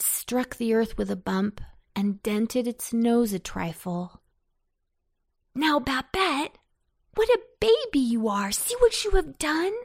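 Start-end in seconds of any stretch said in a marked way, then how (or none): 5.5-9.7 high-pitched brotherly scolding; exasperated boy telling off a crybaby; scolding cry. A narrator's reading. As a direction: scold a little sister, pitch rising. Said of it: "Anton cried".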